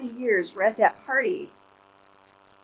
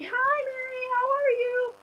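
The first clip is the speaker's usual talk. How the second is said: put-on very high, soft little-girl hello; child's greeting; 3 dB quieter, slower than usual. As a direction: chirp it like a small kid. Child greeting her at car.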